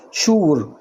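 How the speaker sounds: شور said with a clear sh sound, shoor; sh in sure. Shown as a demonstration. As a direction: say شور with sh at the start. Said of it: "تنطق شين على طول".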